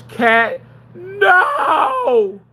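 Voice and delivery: high, loud cry of alarm; alarmed shout. Real, not acted. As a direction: yell at Cat to stop now. Stopping cat again.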